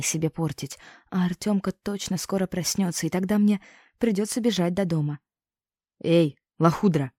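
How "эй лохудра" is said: slow, drawn-out rude hail; calling out rudely. A narrator's reading. Peasant man calling after the girl as she walks off, rude hail. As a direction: yell after her, slow and a bit louder. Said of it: "окликнул меня мужик".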